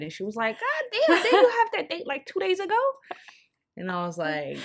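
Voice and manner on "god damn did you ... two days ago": high, loud laughing disbelief. Retold or imitated. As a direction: squeal it through laughter. Friend's laughing reaction.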